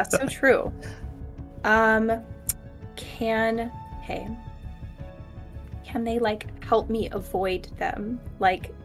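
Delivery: normal talking voice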